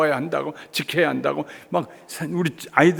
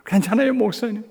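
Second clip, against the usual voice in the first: high, tearful reassurance; smiling through tears; 8 semitones higher than usual. Retold or imitated. Wife answering pastor's question, smiling through tears. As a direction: insist you are fine while crying. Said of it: "눈물을 흘러내려요".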